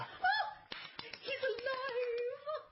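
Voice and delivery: high, slow gasp of relief; delighted surprise. Acted. Hostess relieved after mock death.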